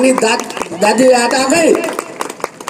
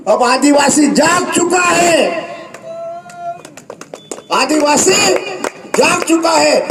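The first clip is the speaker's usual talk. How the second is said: higher, slower, shouted slogan; rallying shout; 7 semitones higher than usual. Real, not acted. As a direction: proclaim it to the crowd.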